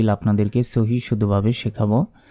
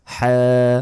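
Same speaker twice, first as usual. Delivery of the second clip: ordinary talk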